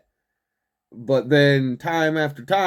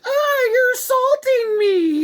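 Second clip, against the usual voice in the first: much higher, louder, wailing cry; mock whiny protester; 4 dB louder than usual. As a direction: ham up a fake-victim wail. Mocking a protester crying assault.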